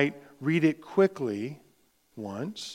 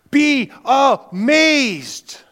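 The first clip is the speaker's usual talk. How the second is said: much higher and louder, stretched out very slowly; soaring exclamation; 11 semitones higher, 11 dB louder, much slower than usual. Acted. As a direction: call out the wonder to the whole room. Preacher exhorts, voice soaring.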